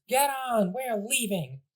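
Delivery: high, huffy command to the troops; bratty retreat order. Acted. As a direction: storm off, nose in the air. Bowser Junior ordering retreat.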